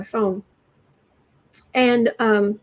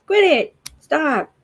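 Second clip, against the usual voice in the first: higher, louder mock scolding; mock yell at the screen; 5 semitones higher, 5 dB louder, slower than usual. Annoyed at a flashing widget on her screen, mock yell. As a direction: tell the blinking thing off, half joking. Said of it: "it's like".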